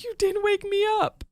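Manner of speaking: high, whiny sobbing complaint; hammed-up wail of a hurt child; imitating a crying kid. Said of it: "bawl her eyes out".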